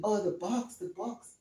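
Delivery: fading murmur of recall; soft musing. Retold or imitated. Student recalls the word box, trailing off softly. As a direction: say it almost to yourself. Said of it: "And you're saying".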